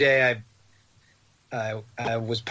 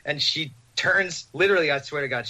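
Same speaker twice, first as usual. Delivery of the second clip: ordinary delivery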